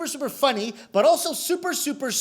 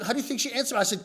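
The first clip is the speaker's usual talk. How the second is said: normal talking voice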